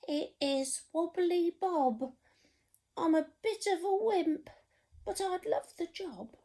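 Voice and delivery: high, wobbly little cook voice; timid cook. Acted. Storyteller voicing the nervous cook, high and timid. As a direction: squeak it out like a nervous wimp.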